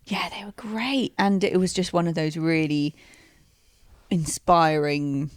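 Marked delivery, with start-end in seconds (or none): 0.1-1.1 whispering. Real, not acted. Whispered agreement.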